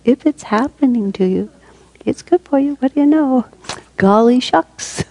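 hammed-up, high singsong with mock wonder; playful saying. Shown as a demonstration. Teacher repeats a favorite saying with gee-whiz delight, hammed-up and playful. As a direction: pitch it way up, drawing out the last words. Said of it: "I love to say it like that".

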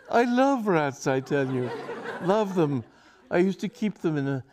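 high, earnest pleading; passionate plea. Acted. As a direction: beg her to understand. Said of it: "he cried passionately".

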